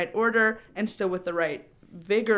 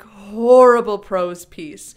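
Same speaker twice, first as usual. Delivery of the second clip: mock drama; 13 dB louder, much slower than usual. Shown as a demonstration. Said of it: "perform your speech as an overly dramatic".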